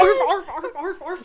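high, loud yappy dog barks; yappy chihuahua voice. Retold or imitated. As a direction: yap like a tiny dog. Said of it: "the dog in the background".

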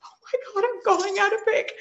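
high astonished gasp of realization; surprised. Retold or imitated. [name] realizing she will announce the pick, high astonished. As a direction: react like you just got the news. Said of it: "And I said".

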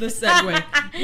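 slow, loud, laughing. Real, not acted. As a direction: drawl it through laughter. Laughing at segue.

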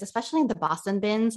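normal talking voice

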